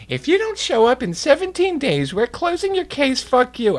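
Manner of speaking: very high mock official voice; official letter voice. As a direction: read it like a snooty notice. Letter read in a put-on voice.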